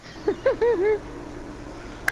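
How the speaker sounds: high breathless giggle; wheezing high laugh; cartoon laugh. Real, not acted. Laughing at friend's trick.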